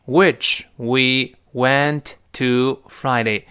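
very slow, word by word; full-form model. Shown as a demonstration. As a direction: dictate each word separately. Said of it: "如果在书面中写全了".

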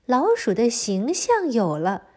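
plain reading voice

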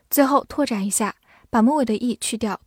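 normal talking voice

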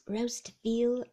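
usual speaking voice

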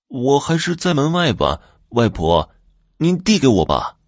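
put-on high little boy voice; lightly voiced child. Youngest son staying outside grandmother's door, lightly voiced child. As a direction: pitch it up like a small boy.

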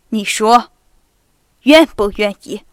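老父亲粗声追问，音量加大; 像严厉父亲一样大声质问; 大声逼问. Acted. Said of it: "他大声地说". (严厉的老公爵大声逼问女儿)

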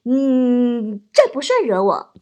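long strained hum, then backing down; mock climb-down after a struggle; holding it in. Husband backs down before his glaring wife, holding it in. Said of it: "老王憋老半天说".

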